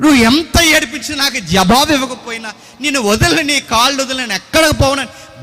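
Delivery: cling on and cry it out; desperate cry. Retold or imitated. David clinging to God in prayer.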